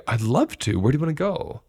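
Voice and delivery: mock eager acceptance, pitch rising on the question; eager yes. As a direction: act thrilled to be asked. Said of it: "my voice is telling the story ... I want this badly".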